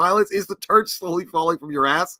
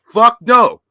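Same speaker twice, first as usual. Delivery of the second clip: emphatic; 7 semitones higher, 9 dB louder than usual. Real, not acted. Emphatic denial to friend.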